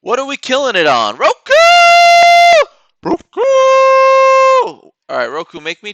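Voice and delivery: high, loud cheering of Roku; loud and high stock-cheering shout; excited shout. Real, not acted. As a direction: yell it like a fan in the stands.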